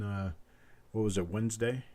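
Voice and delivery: ordinary talk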